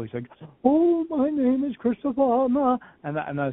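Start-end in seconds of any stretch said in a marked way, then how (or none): 0.6-2.8 high, slow, hammed-up medium voice; mock theatrical channeler introducing himself. Mocking a TV channeler's grand entrance, hammed-up and high. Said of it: "He was so theatrical".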